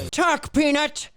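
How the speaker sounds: 1 stern interrogator. Acted. Interrogating a squirrel.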